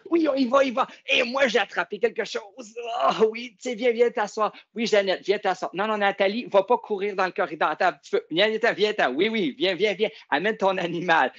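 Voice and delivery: lively calling. Real, not acted. High voice calling children back. (appels aigus aux enfants)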